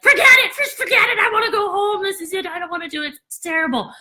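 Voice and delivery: high, laughing give-up whine; mock despair. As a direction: pitch it way up in a hammed-up meltdown. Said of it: "you're like".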